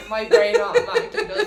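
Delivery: giggling at her own slip; laughing. Real, not acted. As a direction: burst out laughing while talking.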